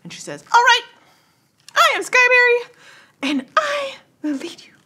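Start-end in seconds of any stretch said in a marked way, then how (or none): 0.5-2.8 high, bright fairy leader. Acted. GM voices fairy leader Skyberry. 3.2-4.7 whispered fairy promise; whispering. Acted. Skyberry continues, dropping to whisper.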